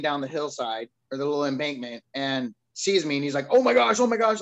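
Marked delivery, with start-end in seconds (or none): 3.5-4.4 much higher and louder panicked oh my gosh; freaked-out exclamation. Retold or imitated. Mower guy finds a bloodied man in the ditch, panicked higher and louder. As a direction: blurt it out in alarm, higher and louder. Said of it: "He freaks out".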